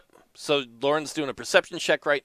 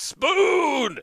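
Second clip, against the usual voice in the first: shout; 5 dB louder than usual. Acted. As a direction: shout at the sky, shaking a fist. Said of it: "shakes his fist and shouts".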